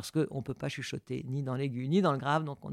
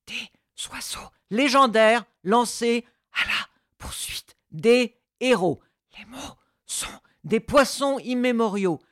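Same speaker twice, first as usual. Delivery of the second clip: loud, high declaiming voice; full projected voice; 7 semitones higher, 8 dB louder than usual. Shown as a demonstration. Coach shows the voice fully released, loud theatrical declaiming. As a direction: declaim it like a stage poem, full out. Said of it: "Je vous reprends exactement la même phrase".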